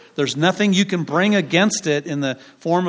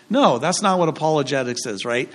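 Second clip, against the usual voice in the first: normal talking voice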